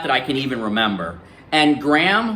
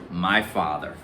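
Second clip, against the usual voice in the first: ordinary delivery, nothing marked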